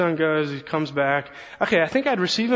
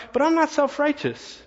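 high, mock-indignant denial; indignant protest; 11 semitones higher than usual. Pastor mocking his own defensive thought, indignant. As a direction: protest your innocence a little too much. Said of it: "But then I thought".

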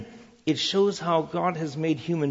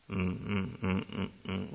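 soft, low hesitant hums; pondering hum; 5 semitones lower, 10 dB quieter, slower than usual. Retold or imitated. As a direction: weigh the purchase with quiet hums. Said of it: "You stand there and think".

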